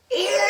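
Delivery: high loud ew; high loud disgusted ew. Acted. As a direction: gag at the sight. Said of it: "We thought we were gonna die".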